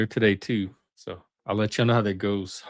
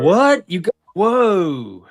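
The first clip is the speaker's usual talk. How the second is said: loud, high, drawn-out amazement; amazed; 8 semitones higher, 7 dB louder, much slower than usual. Real, not acted. Streamer hearing of a new job, amazed. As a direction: exclaim it big, stretching the whoa. Reacts to friend's job news.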